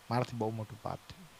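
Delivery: very soft, low admission of guilt; acted remorse, almost too ashamed to say it; tearful confession. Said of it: "રડવાનું શરૂ કર્યું".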